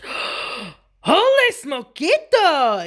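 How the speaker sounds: slow, high, loud storybook astonishment; exclaiming. A narrator's reading. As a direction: draw out a big amazed exclamation. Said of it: "exclaimed Skippy John Jones".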